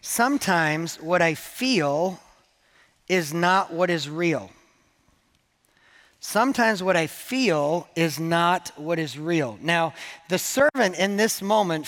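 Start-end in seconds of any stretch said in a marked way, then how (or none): 0.0-4.5 slow, deliberate note-taking pace; slow dictation. Shown as a demonstration. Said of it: "what I want you to write down in your notes". 6.2-9.6 slow emphatic repeat. Shown as a demonstration. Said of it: "write down in your notes".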